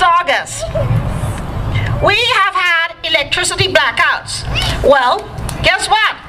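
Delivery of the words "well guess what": usual speaking voice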